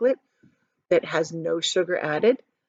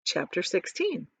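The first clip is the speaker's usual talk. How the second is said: ordinary delivery, nothing marked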